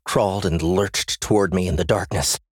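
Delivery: plain reading voice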